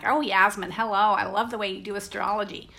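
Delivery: imitating a big New York accent; a friendly New York accent, lightly put on; mock New York accent greeting Yasmin. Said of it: "she's got a really big New York accent".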